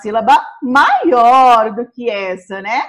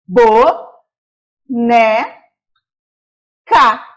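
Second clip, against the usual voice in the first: "bo, né, ca" with long pauses; syllables spaced out; 2 semitones lower, 5 dB louder, much slower than usual. Shown as a demonstration. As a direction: pause after each syllable. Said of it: "Então".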